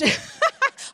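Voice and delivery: short high laugh; a quick high burst of laughter; nervous laugh. Real, not acted. Laugh before recounting hysteria.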